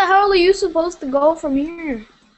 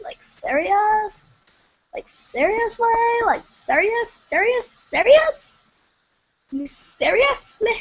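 incredulous; 3 semitones higher than usual. Real, not acted. Streamer upset at having no subscribers, incredulous and whiny. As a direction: keep asking it, pitch climbing each time.

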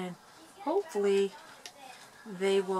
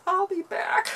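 laughing; 13 semitones higher, 5 dB louder than usual. Real, not acted. Laughing exit after mishap.

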